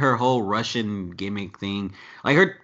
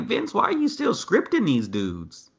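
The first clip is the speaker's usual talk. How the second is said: higher, pleading exasperation at the boss; much higher, fed-up retold complaint; exasperated plea; 12 semitones higher than usual. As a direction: pitch climbing in frustrated disbelief. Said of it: "I'm like".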